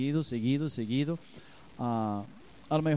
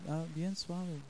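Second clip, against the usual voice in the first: very soft; 4 semitones higher, 8 dB quieter than usual. Shown as a demonstration. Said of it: "nada más háblele".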